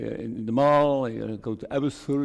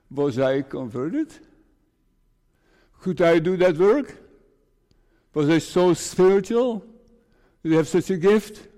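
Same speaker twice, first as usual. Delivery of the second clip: usual speaking voice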